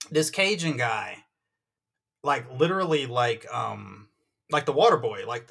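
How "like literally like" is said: usual speaking voice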